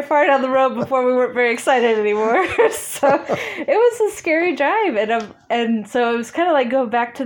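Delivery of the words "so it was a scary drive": high and laughing, a bit slow; giggling at the memory. Real, not acted. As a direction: laugh through the admission. Laughing about scary drive.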